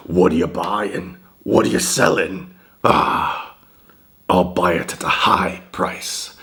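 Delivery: far-off Merchant voice, drawn out with a breathy "Ah"; doing the Resident Evil four Merchant for stream fans; Merchant impression. Imitating the game shopkeeper, salesman drawl. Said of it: "I imitate the Merchant all the time".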